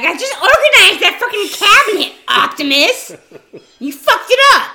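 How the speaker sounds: loud, high, laughing mock rant; mock angry secretary. Imagining a secretary yelling at Optimus, loud and high. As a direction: shriek it like an outraged office worker. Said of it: "They're gonna be like".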